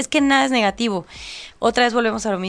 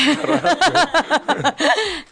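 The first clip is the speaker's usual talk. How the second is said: loud high laugh; embarrassed laugh; 5 semitones higher, 3 dB louder than usual. Real, not acted. Laughing when the host jokes about throwing her out. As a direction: burst out laughing.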